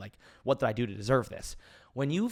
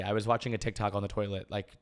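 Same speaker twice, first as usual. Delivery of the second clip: ordinary talk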